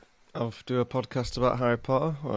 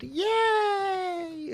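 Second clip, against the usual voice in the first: mock overjoyed cheer. Recapping students' joy at Hagrid's return, high mock cheer. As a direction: build to a hammed-up cheer.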